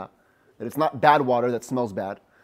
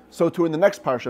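ordinary delivery

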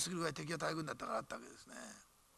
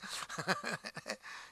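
soft breathy laugh; 4 dB quieter than usual. Real, not acted. Laughs at own list.

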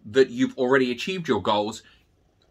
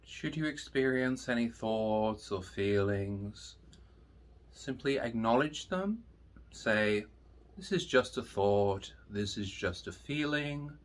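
guided meditation; 10 dB quieter, slower than usual. Acted. Teacher leading students through breathing, slow and soft. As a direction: guide them into calm, slowly.

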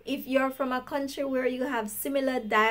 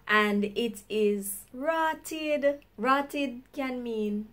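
slow teaching; much slower than usual. Shown as a demonstration. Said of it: "expression which I use a lot".

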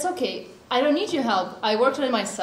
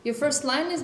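usual speaking voice

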